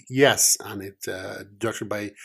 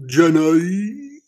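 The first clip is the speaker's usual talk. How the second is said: put-on higher, louder Forrest Gump accent, sounding like a donkey; bad Forrest Gump accent; 6 semitones higher, 4 dB louder than usual. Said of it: "That's not what his accent sounds like".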